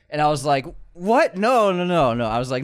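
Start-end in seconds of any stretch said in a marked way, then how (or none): 0.9-2.3 high, loud startled "what" and noes; retold shocked refusal, pitched up. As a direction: blurt it out in mock alarm. Acts out refusing her proposition.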